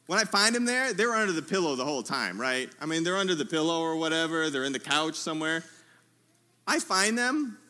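ordinary talk, nothing marked

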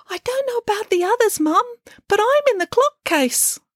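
very high piglet squeak; little piglet voice. A narrator's reading. Youngest piglet answers Mum from hiding, high piglet voice. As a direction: pipe up as a small piglet. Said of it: "And a voice did reply".

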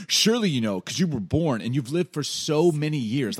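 loud, high, heavily sarcastic jab; God. Acted. God mocking Job's age, loud sarcastic taunt. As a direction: lay the mock deference on thick. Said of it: "He's, like, so sarcastic".

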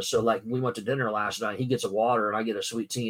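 usual speaking voice

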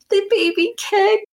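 high, slow, thrilled announcement of the kick; excited news. Retold or imitated. Telling her sleeping husband the baby kicked, thrilled and high.

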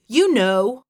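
loud, forceful insistence. A narrator's reading. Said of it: "he said forcefully".

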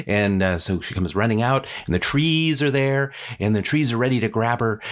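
plain reading voice

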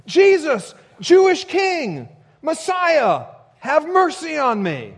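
very high, loud exultant shout; crying out. Acted. Blind beggar at the roadside hailing the Messiah, shouting out. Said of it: "sitting on the side of the road saying".

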